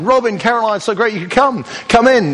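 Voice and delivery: high, bright gushing host greeting; gushing welcome. Pretend. Party host welcoming arriving friends, gushing and high. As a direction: throw the door open and gush at your guests.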